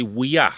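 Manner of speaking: Wea said clearly for him; Cree word demo. Shown as a demonstration. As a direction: model Wea for the class. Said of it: "I'd say".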